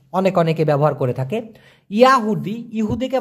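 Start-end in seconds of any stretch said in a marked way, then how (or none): none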